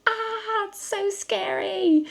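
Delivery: high-pitched pretend scare; hammed-up, drawn-out frightened wail; mock fright. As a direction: play terrified for a child's benefit. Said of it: "the orcs were just like".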